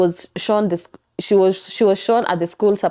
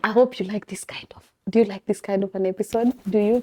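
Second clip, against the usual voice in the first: soft intimate whisper to the camera; 5 dB quieter than usual. Real, not acted. Vlogger closing the episode, asking viewers in a whisper. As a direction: lean in and whisper the question.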